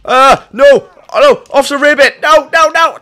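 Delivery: high, loud panicked cries; frantic alarm. Real, not acted. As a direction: panic as your partner goes down. Partner dying in game.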